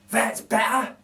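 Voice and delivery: a hint of satisfied relief; relieved burglar. Narrator voices Bill relieved the baby laughs. Bill's voice in read story.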